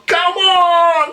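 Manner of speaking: high shouted tennis-style cry; Leighton Hewitt "Come on!". Retold or imitated. In the pub with Dad, a high Hewitt-style shout. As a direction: roar it like a champion. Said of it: "with me old man going".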